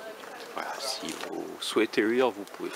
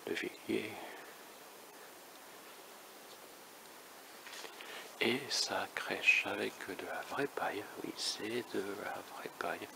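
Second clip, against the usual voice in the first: very soft, half-whispered narration; murmured, mostly whispered visitor's commentary; hushed commentary; 11 dB quieter than usual. Real, not acted. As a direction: keep your voice right down, as in church. Hushed commentary inside a cathedral.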